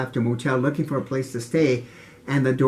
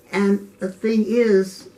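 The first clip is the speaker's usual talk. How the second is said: ordinary delivery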